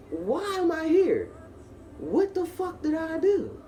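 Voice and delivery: high, drawn-out wail of protest; indignant demand. Retold or imitated. Handcuffed suspect demanding a reason from police, indignant wail. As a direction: demand answers, stretching the words up high. Demanding answers in interrogation room.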